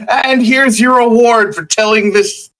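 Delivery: high mock presentation, slowing at the end; imitated award presenter. Retelling being handed a storytelling award, mock presenter.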